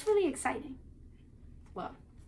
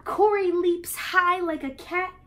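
excited; 8 semitones higher, 7 dB louder than usual. A narrator's reading. Excited storybook reading.

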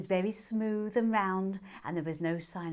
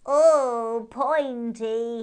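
intrigued hare; 3 semitones higher, 9 dB louder, much slower than usual. A narrator's reading.

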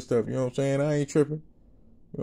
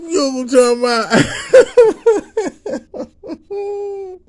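laughing to tears; 9 dB louder than usual. Real, not acted.

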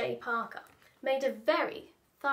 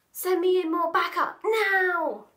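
bossy villain; 6 semitones higher, 7 dB louder than usual. Acted. Boss orders on radio.

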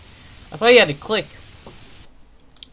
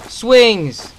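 excited; 8 semitones higher, 8 dB louder than usual. Real, not acted. Excited at spotting swings.

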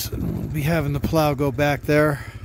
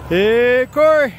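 calling out; 8 semitones higher, 9 dB louder, slower than usual. Real, not acted. Calling to the plow driver.

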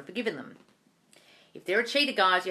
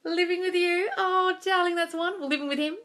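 higher, bubbly delight easing down; gushing delight; 10 semitones higher than usual. Real, not acted. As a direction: coo over her good news. Gushing over viewer's news.

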